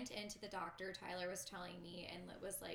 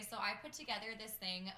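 normal talking voice

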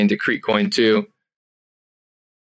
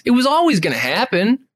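ordinary delivery

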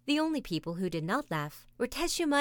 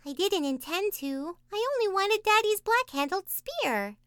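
put-on Taffy, excited child; 12 semitones higher, 3 dB louder than usual. A narrator's reading. Taffy voiced.

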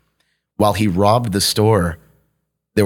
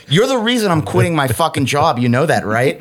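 higher-pitched accusing mock rant; worked-up complaint, pitched up but not shouted; retold yell; 7 semitones higher than usual. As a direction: reenact an indignant telling-off. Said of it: "I yelled at him".

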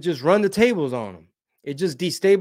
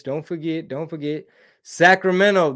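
ordinary talk

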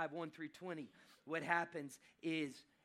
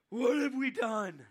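high, very loud, stretched-out cry; panicked wail; 7 semitones higher, 14 dB louder, slower than usual. Acted. Brothers realising who Joseph is, loud panicked wail. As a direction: wail it in mock horror.

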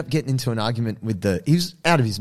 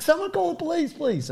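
urgent plea; 3 dB quieter than usual. Retold or imitated. Bystander begs onlookers to call police, urgent plea. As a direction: plead for help in a strained high pitch.

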